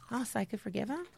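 imitated tiny, unsure question; very soft, rising, hesitant question; small meek voice. Daughter on phone, retold.

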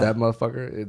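usual speaking voice

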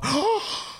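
high, hammed-up offended gasp; mock gasp. Girlfriend hearing the insult, hammed-up shocked gasp. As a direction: gasp like she's been insulted. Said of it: "she's gonna go".

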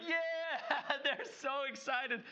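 put-on high, thrilled cheer of the lucky kid; excited whoop. Kid who did one push-up, cheering his pay, thrilled. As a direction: squeal like the kid who got paid big.

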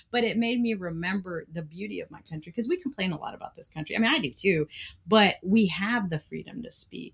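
usual speaking voice

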